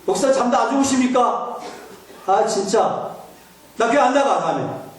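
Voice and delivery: high, laughing protest from a woken deacon; sleepy deacon protesting. Retold or imitated. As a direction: whine at a pastor calling at 2 a.m. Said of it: "이럴 수 있죠".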